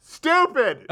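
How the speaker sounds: high loud heckle; mock yell at the movie; dad yelling. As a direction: shout it at the screen. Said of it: "my dad just yelled out".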